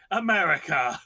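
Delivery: loud, jokey cry of AMERICA; playful loud exclamation; mock exclamation. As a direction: call it out loudly as a joke. Joking exclamation about the country.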